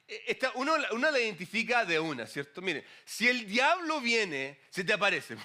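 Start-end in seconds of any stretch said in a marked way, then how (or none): none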